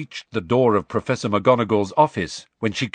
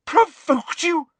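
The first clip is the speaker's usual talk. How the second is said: slow, very high, furious echo; incredulous shout; 4 dB louder, slower than usual. A narrator's reading. McGonagall rejects Harry's excuse, high furious shout. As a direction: throw the words back in outrage. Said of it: "shouted Professor McGonagall".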